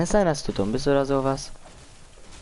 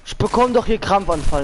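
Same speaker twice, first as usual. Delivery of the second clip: raging gamer; 6 semitones higher, slower than usual. Real, not acted. Frustrated outburst mid-game.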